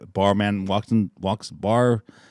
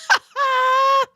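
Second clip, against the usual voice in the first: shrieking laugh. Real, not acted. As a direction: cackle at your own punchline. Laughing at own joke.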